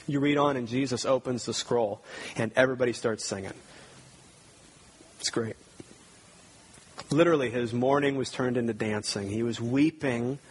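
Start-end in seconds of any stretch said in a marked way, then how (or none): 5.2-5.5 whispered aside. Real, not acted. Aside after retelling joyful scene.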